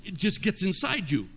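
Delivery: full-force preaching. Acted. Preacher urging the congregation to be thankful, full force. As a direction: preach it hard, building to a shout.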